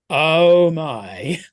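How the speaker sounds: loud, drawn-out mock dismay; imitated appalled reaction. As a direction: act out a horrified "oh my". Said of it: "Mills and Somerset go".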